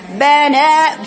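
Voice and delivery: "بنا" loud and high, both syllables held strong; forceful. Shown as a demonstration. Teacher coaches a sleepy student, forceful "بنا". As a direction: punch "بنا" with full voice. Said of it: "هاتي القوة في بناها".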